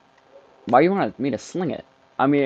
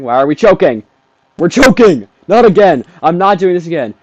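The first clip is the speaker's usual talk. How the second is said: loud, rising alarm; exasperated yelling; 6 semitones higher, 9 dB louder than usual. Real, not acted. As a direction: shout in disbelief as the team collapses. Panicking as the lead slips away.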